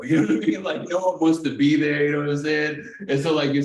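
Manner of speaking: laughing. Real, not acted. Joking to a friend about the probation office, laughing. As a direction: chuckle through it.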